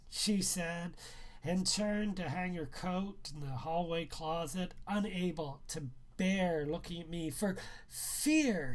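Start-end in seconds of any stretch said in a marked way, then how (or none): none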